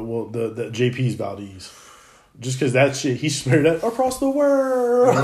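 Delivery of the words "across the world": high, slow, stretched-out words; drawn-out exaggeration. Real, not acted. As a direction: stretch it out for comic effect.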